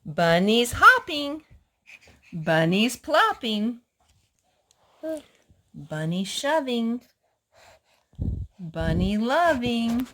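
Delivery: slow, sing-song picture book reading; slow storybook read-aloud; reading to children. Acted. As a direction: linger on each bunny word for little ears.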